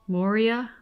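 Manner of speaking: ordinary talk, nothing marked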